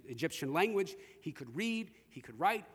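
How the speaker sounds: usual speaking voice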